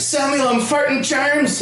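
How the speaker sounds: put-on high, slowed Northern Irish granny accent. Grandma explaining her farts in high Irish-accented granny voice. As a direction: announce it proudly in Irish accent. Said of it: "she sounded like Shrek".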